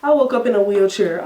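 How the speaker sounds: ordinary talk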